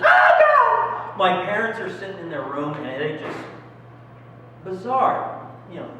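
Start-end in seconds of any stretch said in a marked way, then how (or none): 0.0-1.2 sudden piercing high scream; screamed Oh God. Retold or imitated. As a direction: shriek it at the top of your lungs. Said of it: "She screamed, just let out a scream".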